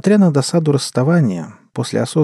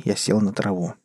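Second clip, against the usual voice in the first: ordinary delivery, nothing marked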